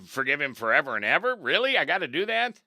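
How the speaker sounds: high, loud, disbelieving whine; mock Peter balking at the idea; incredulous Peter. A preacher voicing a doubtful Peter, high and loud. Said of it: "So Peter was saying".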